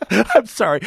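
breaking into laughter; apologizing through real laughter; laughing. Real, not acted. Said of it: "I can't keep a straight face".

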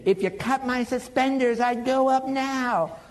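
high, drawn-out mock-excited holler; imitated elated cry, stretched at the end; excited impression. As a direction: holler it as if bursting for heaven. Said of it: "he was so excited about going to heaven".

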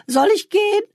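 put-on young woman calling through a half-open door. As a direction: call out from the next room. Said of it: "rief sie durch die angelehnte Tür".

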